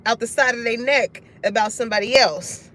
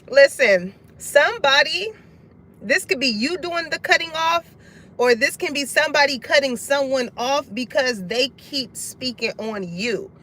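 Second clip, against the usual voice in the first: usual speaking voice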